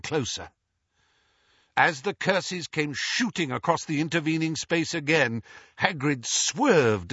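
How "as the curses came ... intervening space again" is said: ordinary delivery, nothing marked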